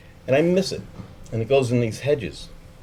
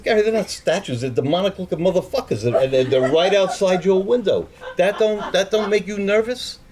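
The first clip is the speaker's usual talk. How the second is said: agitated pleading; 9 semitones higher, 3 dB louder than usual. Retold or imitated. Arguing about demonic statues.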